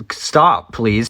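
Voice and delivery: normal talking voice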